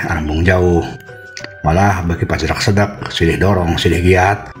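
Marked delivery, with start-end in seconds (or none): none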